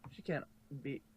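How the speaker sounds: ordinary delivery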